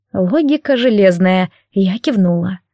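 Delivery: ordinary delivery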